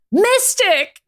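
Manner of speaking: high, loud shout of recognition. Acted. Ryan shouts on seeing Mystique, loud and high. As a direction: call her out as the chair spins. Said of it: "Ryan shouted".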